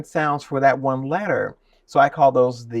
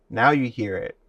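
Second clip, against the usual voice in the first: usual speaking voice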